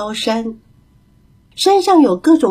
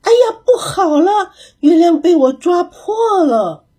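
小猴子吓得大喊; frightened yell; 7 semitones higher than usual. A narrator's reading. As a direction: shriek as if you broke the moon. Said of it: "小猴子吓得大喊".

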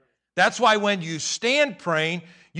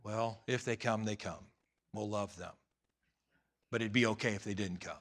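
wry aside; 9 semitones lower, 12 dB quieter than usual. Retold or imitated. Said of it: "I'm like".

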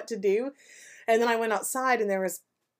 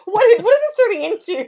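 1 laughing protest; 13 semitones higher, 11 dB louder, much faster than usual. Real, not acted. Teased by partner, laughing hard.

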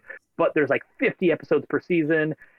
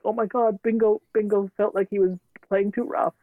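high-pitched, imitating tearing up; mock tearful; 7 semitones higher than usual. Parent retelling their reaction to the kids' show, mock tearful. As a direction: play at choking up over a cartoon. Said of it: "You'll just be tearing up".

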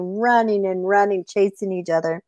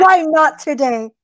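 higher-pitched impatient coyote; demanding coyote; 7 semitones higher, 3 dB louder than usual. Acted. Coyote holding rabbit demands a reason, impatient. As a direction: snap the question in mock annoyance.

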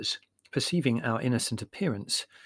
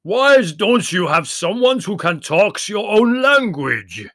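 booming, slow bellow with raised pitch; roar; 8 semitones higher, 13 dB louder, slower than usual. A narrator's reading. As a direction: roar it out over the uproar. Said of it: "roared the voice".

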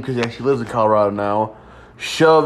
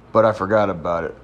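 usual speaking voice

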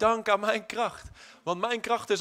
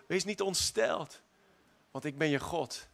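ordinary talk

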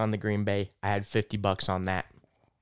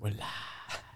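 hushed radio DJ announcing; whispered DJ intro, playful; 9 dB quieter than usual. Acted.

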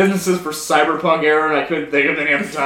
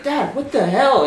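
higher, drawn-out protest to dad; put-on kid's dismay; mock dismayed kid; 6 semitones higher, slower than usual. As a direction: act out an upset kid complaining. Acting kid in retold meme.

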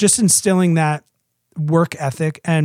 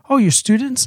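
put-on curious toddler; 7 semitones higher than usual. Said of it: "She's like".

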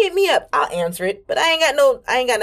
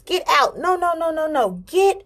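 high, strained mock toddler yelling; high childish shriek with a catch of tears; retold toddler screaming; 10 semitones higher than usual. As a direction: relive a little kid screaming at an intruder. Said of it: "I'm screaming to the top of my lungs".

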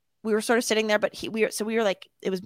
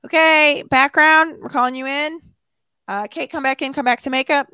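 loud, higher-pitched calling out; yelled set announcement; 6 semitones higher, 6 dB louder than usual. Retold or imitated. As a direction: call everyone in from the doorway. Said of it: "sort of yells out".

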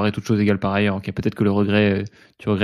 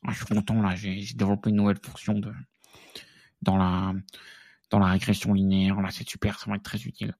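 softer, lightly voiced proud geek; geek Mbappé voice; 6 dB quieter than usual. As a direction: play a mild self-satisfied nerd. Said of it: "un mix de voix de geek et de Kylian Mbappé".

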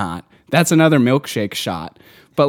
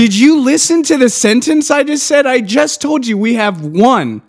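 loud, high exasperated outburst; high-pitched disbelief; angry rant; 9 semitones higher, 4 dB louder than usual. Real, not acted. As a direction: vent your fury at the customer. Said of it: "It's because I'm getting so angry".

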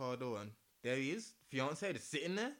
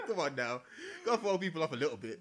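louder, laughing through it; 3 semitones higher, 7 dB louder than usual. Real, not acted. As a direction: chuckle the words out. Laughing while telling.

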